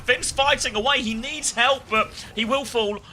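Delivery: excited. Real, not acted. Caster reacting to a fight.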